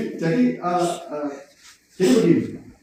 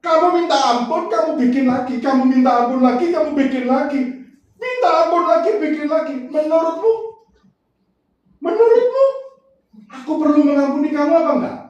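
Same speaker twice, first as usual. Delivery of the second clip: higher, louder turned-round challenge; Jesus asking him, higher, pressing; God questioning him; 11 semitones higher, 4 dB louder than usual. Retold or imitated. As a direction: fire the questions back at him. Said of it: "Waktu saya dibalik begitu".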